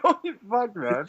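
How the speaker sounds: high, startled mock reaction; shocked. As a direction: blurt it out in shock. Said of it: "I'm like".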